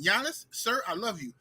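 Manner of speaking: usual speaking voice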